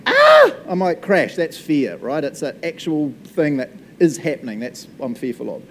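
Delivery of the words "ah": sudden high loud yelp of fright; startled yelp. Retold or imitated. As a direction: yelp as a car swerves at you. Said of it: "and I'm like".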